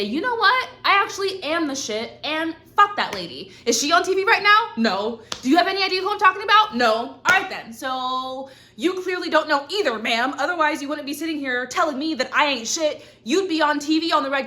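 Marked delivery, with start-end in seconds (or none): 0.0-3.5 high, defiant "you know what"; sassy self-talk. Retold or imitated. Quoting her own defiant inner voice, sassy and high. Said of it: "I had enough time to say". 3.6-10.8 higher, louder, fast mock interrogation; sassy challenge. Confronting an imagined hater, loud sassy mock questioning.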